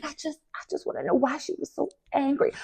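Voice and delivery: tiny, high, very soft voice; mock scared. Imitating a cast member cowering from a castmate, mock frightened. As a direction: shrink into a hammed-up timid little plea. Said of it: "acted like she was scared".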